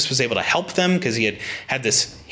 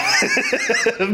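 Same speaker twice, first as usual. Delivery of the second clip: high squeal of laughter. Real, not acted. Laughs at own embarrassment.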